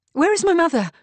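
loud, high-pitched urgent demand. Acted. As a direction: demand an answer at once. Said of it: "demanded Kate".

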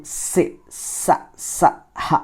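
సే, స, స, హ as separate unvoiced puffs of air; blown-out breathy sounds. Shown as a demonstration. Teacher demonstrating sibilants to students, సే, స, స, హ blown out. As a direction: blow each of సే, స, స, హ out on the breath. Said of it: "ఇవి ఊది పలకడం జరుగుతుంది".

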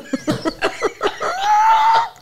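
shrieking high burst of laughter; loud laugh. Real, not acted. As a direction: let out a squealing laugh. Laughing at her own fall.

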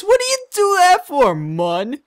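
very high, loud exasperated Drippy. Acted. Drippy scolds Oliver for accepting the pot, high exasperated. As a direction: squeal in exasperation.

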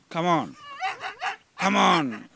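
from firm call to loud shout, rising; building attack command. Real, not acted. Dog trainer urging attack.

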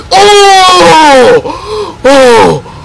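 loud, raw shout of disbelief; excited shout. Real, not acted. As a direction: yell it out like you can't believe the pull. Huge pull in pack opening.

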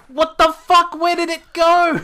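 high, very loud outraged shout; pissed outburst. Real, not acted. Player who lost a Jigglypuff, pissed shout. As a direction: shriek it in disbelief. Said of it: "I'm so pissed".

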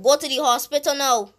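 loud, commanding order; urgent order. Acted. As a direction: order them off urgently, loudly. Ordering the injured character away.

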